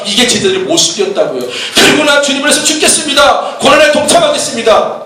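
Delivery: preacher mimicking earnest disciples mid-exhortation; impassioned preaching. Real, not acted. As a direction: drive the point home urgently.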